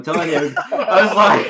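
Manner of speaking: laughing through the words; cracking up while telling it. Real, not acted. Laughing telling drench story.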